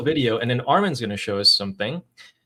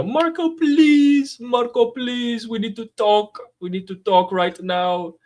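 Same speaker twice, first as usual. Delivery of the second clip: put-on high, slow Russian accent, pleading; a begging Russian minister in a thick Russian accent; 12 semitones higher, 5 dB louder, slower than usual. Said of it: "could do Russian accent?".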